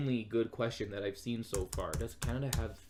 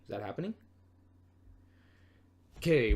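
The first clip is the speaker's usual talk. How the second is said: normal talking voice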